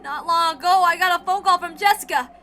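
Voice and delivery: a young man breaking bad news; grim young man. Battler voiced on the phone.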